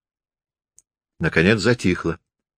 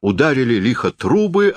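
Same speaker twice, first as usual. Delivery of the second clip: plain reading voice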